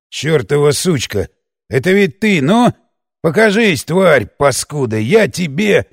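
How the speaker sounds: put-on spitting curses at an unseen girl; angry tirade. A narrator's reading. Chained man snarls curses at the girl. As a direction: rage at her, half-choked. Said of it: "Гневная тирада оборвалась".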